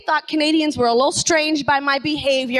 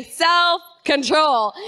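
"Self-control" said very slowly for the room to echo; slow prompt; much slower than usual. Shown as a demonstration. Pastor models "Self-control" slowly for the congregation to repeat. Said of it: "Look at the person beside you, say".

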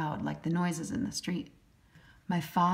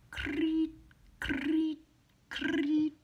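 high chirping creet; cricket chirp; 10 semitones higher, much slower than usual. A narrator's reading. Narrator imitating crickets in the grass. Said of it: "The crickets go".

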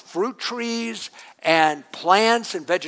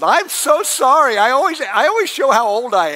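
mock apology; 6 semitones higher, 5 dB louder than usual. Real, not acted. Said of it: "boy, I am old".

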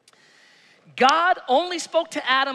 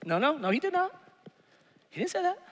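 usual speaking voice

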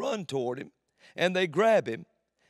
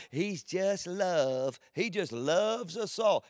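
mocking sweet tone; 4 semitones higher, slower than usual. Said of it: "And you say".

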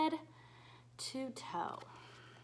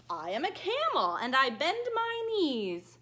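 ordinary talk, nothing marked

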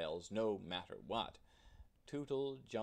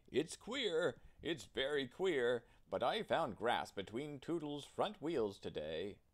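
higher, louder puzzled workman; puzzled oiler; 4 semitones higher, 4 dB louder than usual. A narrator's reading. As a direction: wonder aloud at something odd. Chief oiler voiced, puzzled.

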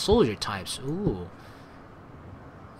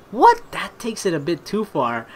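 shocked disbelief; 3 semitones higher than usual. Real, not acted. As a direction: blurt it in amused shock. Shocked by steak knife escalation.

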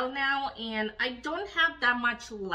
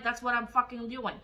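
ordinary talk

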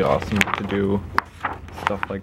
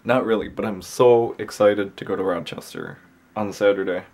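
normal talking voice

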